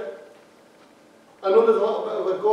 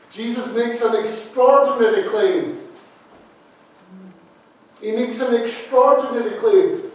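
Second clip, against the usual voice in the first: ordinary talk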